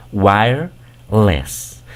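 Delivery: "wireless" drawn out slowly; slow English word. Shown as a demonstration. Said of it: "Si ya sabes pronunciar esta". ("wireless" muy despacio, en inglés)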